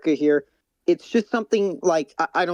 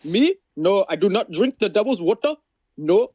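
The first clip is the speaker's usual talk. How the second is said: higher-pitched put-on Indian accent; mock Indian accent; 5 semitones higher than usual. Bantering as a teetotal parent ordering homework, mock Indian accent. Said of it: "Do an Indian accent right now".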